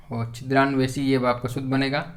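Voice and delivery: ordinary delivery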